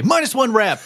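high announcement breaking into laughter; laughing. Acted.

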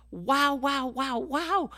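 high, loud, breathless wows of wonder; awed wows. Real, not acted. Preacher overwhelmed after reading the throne chapter, excited wows. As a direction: let the awe burst out.

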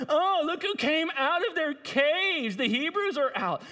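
mock them like an online troll; trolling Philistines. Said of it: "They're just absolute trolls".

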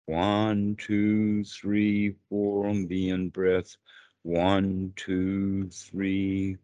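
measured, chant-like even count; slow counting. Shown as a demonstration. Teacher pacing in-breaths and out-breaths, counting slowly. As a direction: hold each number long and level, easing off at the end. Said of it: "Counting slowly".

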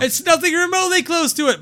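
high, loud, fast complaint; exasperated shout. Real, not acted. Horror fan insisting the mask is wrong, exasperated shout. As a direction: keep yelling in disbelief. Ranting about a mismatched mask.

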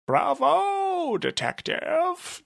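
very high, drawn-out bravo; villain applauds the detective's outburst; mock bravo. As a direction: applaud him in a sing-song squeal. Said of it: "Gentleman applauded".

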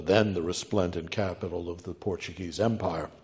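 ordinary talk

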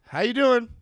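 high, loud, carefree hello while running past; cheery drunk greeting. Retold or imitated. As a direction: toss it off brightly, like nothing's wrong. Drunk handcuffed guy runs past.

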